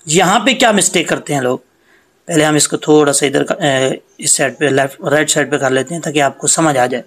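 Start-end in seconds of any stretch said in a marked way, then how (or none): none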